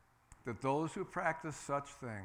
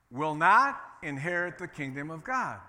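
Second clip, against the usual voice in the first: loud call-and-response line; loudly; 10 dB louder than usual. Shown as a demonstration. Preacher saying it loudly with the congregation. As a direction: belt it out with the church. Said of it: "Say it with me, loudly".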